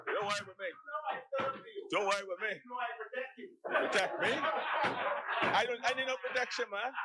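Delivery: high, fast, heated protest; heated outburst. Real, not acted. Heated retort across the chamber.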